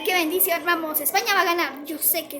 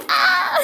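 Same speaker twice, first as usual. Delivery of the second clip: high, loud squeal with laughter; laughing yelp; 12 semitones higher, 6 dB louder than usual. Acted. Se asusta y ríe. (chillido agudo entre risas)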